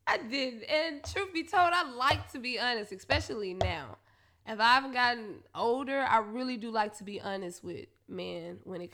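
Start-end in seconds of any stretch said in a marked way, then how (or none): none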